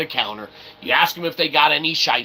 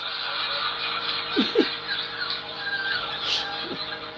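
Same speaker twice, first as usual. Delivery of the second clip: high wheezing laugh; 9 dB quieter than usual. Real, not acted. Laugh burst.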